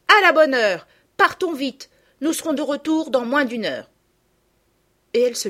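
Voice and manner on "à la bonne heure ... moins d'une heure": loud, high call to set off; eager girl. A narrator's reading. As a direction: cheer them on to leave. Girl eagerly urges setting off. (fillette enthousiaste et décidée)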